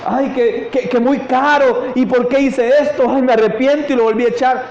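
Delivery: high-pitched, hammed-up lament; imitated fretting, pitched well up; mock regretful woman. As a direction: play a woman wailing with regret. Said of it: "¿Por qué se puso a llorar?".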